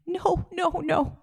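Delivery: high-pitched imitated wail; retold crying. Mom hears about palliative care, high mock crying. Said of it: "my mom starts crying".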